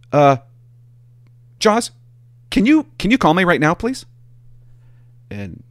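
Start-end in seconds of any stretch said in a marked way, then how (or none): 0.1-4.1 high, pleading dispatcher imitation; imitating a high-voiced dispatcher calling in. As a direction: mimic the dispatcher asking sweetly, pitched up. Dispatcher voiced in retold call.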